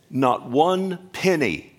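slow, high and loud, each word hammered; emphatic preaching. Real, not acted. Pastor telling the congregation no budget money was used, slow and forceful. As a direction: drive home "Not one penny" slowly. Preacher stressing church finances.

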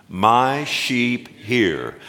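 slow recitation. Shown as a demonstration. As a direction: lead the congregation through it. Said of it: "Say that with me".

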